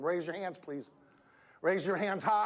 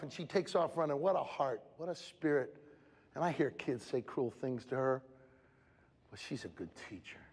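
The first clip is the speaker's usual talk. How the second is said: ordinary delivery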